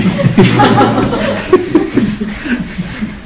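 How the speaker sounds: long, high wheezy laugh; amused giggle. Real, not acted. Storyteller laughing at the ghost keeper's excuse, high giggle. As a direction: giggle helplessly at your own punchline. Laughs at his own punchline.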